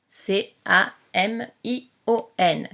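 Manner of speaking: letters spelled out slowly, one by one; slow dictation spelling. Shown as a demonstration. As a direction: spell it slowly for a child writing. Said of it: "spelling the word for dictation".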